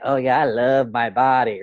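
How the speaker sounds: imitating a self-satisfied boast, lightly voiced; smug ego statement. A man mocking ego-driven self-love, smug and pleased. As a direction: say I love my body as a smug show-off. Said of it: "in the way of that ego statement versus".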